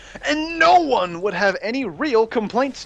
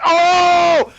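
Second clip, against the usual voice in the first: mock startled yelp; 7 semitones higher, 6 dB louder than usual. Imitated victim's shout.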